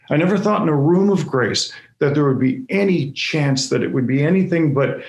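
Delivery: ordinary delivery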